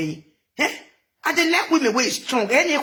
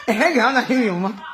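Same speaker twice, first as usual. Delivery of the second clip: laughing tease. Real, not acted. Laughing through repeated tease.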